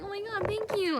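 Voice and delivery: gushing thanks. Retold or imitated. Thanking the friend for chocolates, high gushing. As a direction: gush over the gift.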